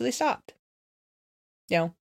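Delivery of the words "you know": normal talking voice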